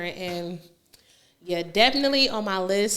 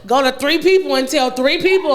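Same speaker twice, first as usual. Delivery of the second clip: pastor voice; 3 semitones higher, 7 dB louder than usual. Retold or imitated. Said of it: "when the pastor start giving directions".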